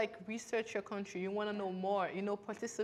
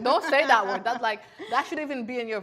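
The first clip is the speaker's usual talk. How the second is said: scolding parent; 4 semitones higher, 10 dB louder than usual. Retold or imitated. Imitating parents forbidding the word Zoe, loud laughing scold. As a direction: play the strict parent shutting it down.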